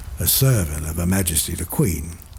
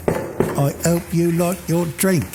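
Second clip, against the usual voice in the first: higher, slow, lightly voiced dying man; dying Gunga Din; 8 semitones higher than usual. Gunga Din speaking his last words to the soldier, slow and higher. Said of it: "says Gunga Din".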